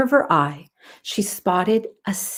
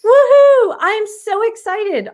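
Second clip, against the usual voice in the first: high, loud joyful cheer; 13 semitones higher, 7 dB louder than usual. Acted. Girl cheering to her friend about the beach trip, thrilled. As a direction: whoop it out like a thrilled kid. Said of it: "Aubrey cheered".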